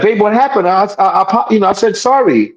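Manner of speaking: high, hurt mock pleading; bewildered boyfriend. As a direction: plead innocence as the confused boyfriend. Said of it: "he's like".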